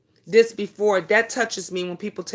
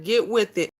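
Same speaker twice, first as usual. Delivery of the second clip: ordinary delivery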